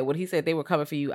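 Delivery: usual speaking voice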